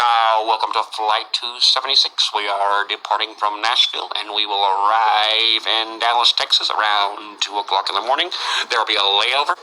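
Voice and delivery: pilot announcement. Retold or imitated. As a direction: welcome the passengers like a captain. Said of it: "he gets on the intercom".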